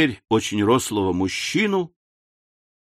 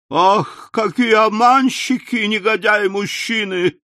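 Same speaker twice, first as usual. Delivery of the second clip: higher, an anguished cry; wailing lament; 9 semitones higher than usual. A narrator's reading. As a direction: cry out at the cheating men. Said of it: "восклицала она". (причитает высоким голосом)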